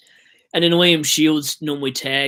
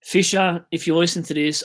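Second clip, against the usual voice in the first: calling out; 5 semitones higher, 4 dB louder than usual. Real, not acted. Calling out his failed captain.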